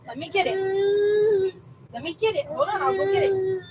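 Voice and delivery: high, sing-song coaxing voice. Real, not acted. Mom offering her teething toddler a popsicle, high soothing coo.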